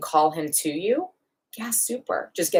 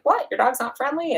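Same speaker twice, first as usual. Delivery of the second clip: higher, mock incredulous stranger; surprised stranger; 10 semitones higher than usual. Imitating a surprised passer-by questioning the trainer, mock incredulous. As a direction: play a stranger taken aback at being refused. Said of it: "somebody that's like".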